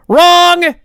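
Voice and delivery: high, loud gotcha; gleeful shout. Real, not acted. Host springing the twist on viewers with a high shout. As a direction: shout it like a game-show buzzer. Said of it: "gotcha reveal to viewers".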